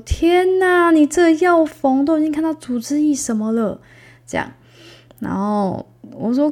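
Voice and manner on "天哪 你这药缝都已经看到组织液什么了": high, loud shocked exclamation; shocked nurse. Retold or imitated. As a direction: gasp at the wound. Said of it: "护士阿姨就说".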